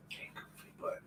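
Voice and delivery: hushed whisper to the dog. Real, not acted. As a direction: barely breathe it.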